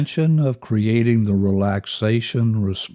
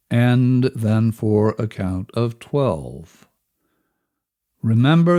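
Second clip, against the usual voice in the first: normal talking voice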